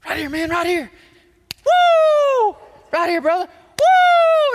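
high, loud high-five shouts and whoops; acted-out jubilant fan; celebrating stranger. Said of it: "complete strangers would walk up to me and go".

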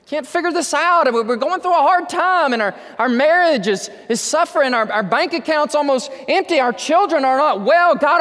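high, loud, desperate cry to God; a panicked prayer shouted heavenward; anxious pleading. Acted. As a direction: plead like a frightened believer.

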